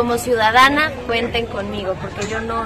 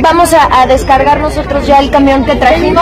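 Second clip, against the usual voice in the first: much louder voice, projecting over the crowd; loud over the noise; 8 dB louder than usual. Real, not acted. Announcing the aid truck to a noisy crowd, loud and projected.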